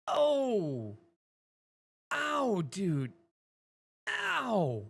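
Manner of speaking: high, loud yelps of sympathetic pain; pained cry. Real, not acted. Streamer reacting to something painful, crying out in pain. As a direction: cry out as if it hurts.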